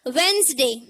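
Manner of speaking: वेंसडे as two syllables, "wens-day"; silent d. Shown as a demonstration. Teacher showing what it becomes, वेंसडे as "wens-day". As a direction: say वेंसडे without the first d. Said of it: "इसे silent रखना है".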